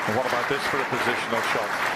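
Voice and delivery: fast, high, loud and thrilled; excited commentary. Real, not acted. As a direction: build up the excitement.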